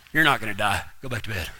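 low, soft brush-off; dismissive dad. Retold or imitated. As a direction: imitate an unimpressed father, very low. Dad dismisses the dramatic complaint.

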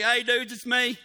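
loud, easy-going hey dudes; Jesus hollering to scared disciples, relaxed and loud; casual Jesus. Retold or imitated. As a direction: call out loud and breezy across the water. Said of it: "And Jesus is like".

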